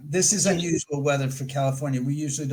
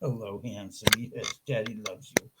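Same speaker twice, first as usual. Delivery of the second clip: cooing to dog; 8 semitones lower, 8 dB quieter than usual. Real, not acted. Owner comforting his soaked, unhappy dog in soft cooing tones. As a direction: soothe your soaked dog gently.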